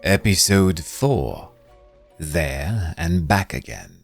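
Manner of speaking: plain reading voice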